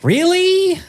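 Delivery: high, drawn-out incredulous question. Real, not acted. Surprised reaction to hated-guy confession.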